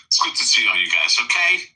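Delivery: put-on low, heavy Chicago accent; hard Chicago accent. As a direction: lay the Chicago accent on thick. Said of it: "I was trying to do a hard Chicago accent".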